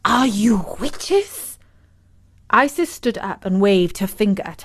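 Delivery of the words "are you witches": slow, cracked, fearful question; cracked voice creeping upward. A narrator's reading. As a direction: ask slowly with a cracking voice. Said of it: "Agrippina spoke in a cracked voice".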